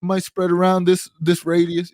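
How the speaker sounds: a lightly voiced online theorist working out the blood-bag radius; theory video voice. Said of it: "one of those kind of videos".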